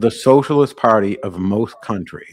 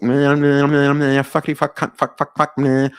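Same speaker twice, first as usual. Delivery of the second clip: Tourette imitation; 4 semitones higher than usual. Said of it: "is when somebody goes like".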